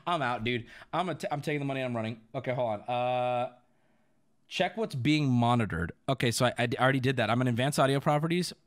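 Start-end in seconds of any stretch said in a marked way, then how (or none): none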